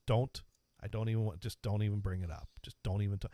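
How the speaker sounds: low, quiet brush-off without anger; retold curt dismissal. Classmate refusing to hear his apology, quietly dismissive. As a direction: softly shut the apology down. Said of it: "he didn't get angry at me".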